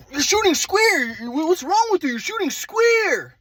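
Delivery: dad yelling at his son before school starts. Retold or imitated. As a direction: ham up dad's angry yelling in the backyard. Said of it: "My dad's like".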